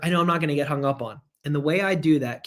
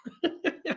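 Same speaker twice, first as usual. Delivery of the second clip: high, soft laugh; 12 semitones higher, 7 dB quieter than usual. Real, not acted. Coach after getting really passionate, amused laugh. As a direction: laugh quietly at your own outburst.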